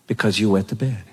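low, firm scolding; low voice, pinning her down; stern dad. Retold or imitated. Dad reminding his daughter she wet the bed, low and firm.

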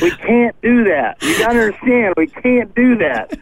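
mock-helpless objection; retold protest. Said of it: "and I was like".

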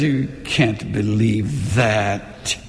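slow, low sneering whisper of doubt; taunting enemy. Retold or imitated. Pastor voicing the enemy's lie at night, slow and low. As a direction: hiss it like the tempter. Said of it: "the enemy says".

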